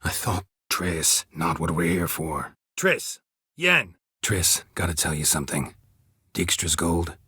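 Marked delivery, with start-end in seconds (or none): 2.8-3.2 high urgent call of her name; sharp, much higher cry across the room. Acted. Calling out to Triss. 3.6-3.9 high, loud call of her name; sudden much higher shout. Acted. Calling out to Yen.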